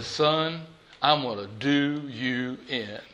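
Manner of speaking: very low, soft, slow deadpan threat; old pastor. Retold or imitated. Old pastor to the cocky young preacher, low and quiet. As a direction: drop right down and take your time, quietly confident.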